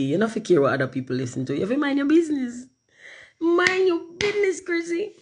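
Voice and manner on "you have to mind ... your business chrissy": very high-pitched telling-off; mock scolding. Streamer telling Chrissy off, high mock scolding. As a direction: squeal the reprimand way up high.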